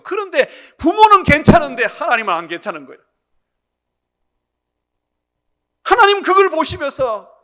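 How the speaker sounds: loud, high-pitched sermon delivery; forceful preaching. Real, not acted. Preacher warning children who mistreat parents, forceful preaching. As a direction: drive the warning home to the congregation.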